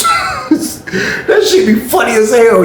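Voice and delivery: drawn out through laughter; real laughter while telling it; laughing. Real, not acted. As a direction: laugh it out slowly.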